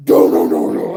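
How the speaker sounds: strained "no, no, no" in alarm; mock panic. Driver reliving hitting the speed bump, panicked. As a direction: blurt it like you feel the axle crack.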